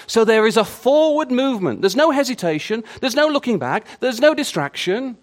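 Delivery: loud, high preaching with rising force; fiery preaching. Acted. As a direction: preach it out to the congregation.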